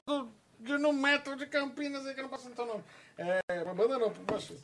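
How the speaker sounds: fading to a near mumble; trailing off. Real, not acted. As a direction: let the words die away unsure. Can't say the band's name.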